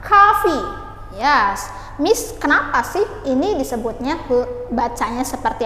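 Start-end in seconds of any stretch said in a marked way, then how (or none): 0.0-0.6 coffee said as kafi, high and clear; model pronunciation. Shown as a demonstration. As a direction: say coffee as KAH-fee with a bright falling tune. (coffee diucapkan kafi, nada tinggi lalu turun)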